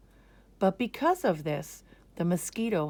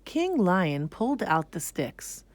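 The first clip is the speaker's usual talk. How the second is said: plain reading voice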